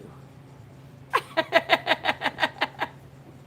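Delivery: airy unvoiced chuckle; breathy laugh. Real, not acted. Laughs after teasing a story.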